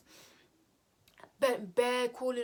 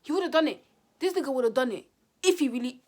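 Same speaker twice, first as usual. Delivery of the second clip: louder insisting sister; 3 semitones higher, 10 dB louder than usual. Retold or imitated. Sister arguing the ex would have done it, louder. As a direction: drive the point home hard.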